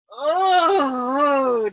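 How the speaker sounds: a loud, crying moan called across the room; answering moan. A narrator's reading. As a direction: moan back loudly from the far end. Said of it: "Dunbar answered Yossarian".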